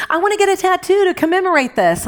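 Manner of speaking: put-on high, fast, eager young-sister voice. Young sister on a road trip wanting a tattoo, high and eager. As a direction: imitate an excited younger sibling, quick and high. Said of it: "'cause she's young".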